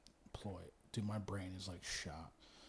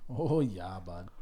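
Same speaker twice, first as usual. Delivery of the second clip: hammed-up Upper Midwest accent, louder; Wisconsin Canadian-ish accent; 3 dB louder than usual. Host mocking Wisconsin folks in a hammed-up Upper Midwest accent. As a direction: lean into the Wisconsin "oh yeah, bud" accent. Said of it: "They talk more Canadian".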